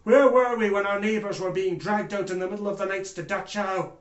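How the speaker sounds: forceful, indignant courtroom outcry; impassioned accusation. Acted. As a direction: hammer each WHERE WERE WE. Impassioned accusation of himself and nation.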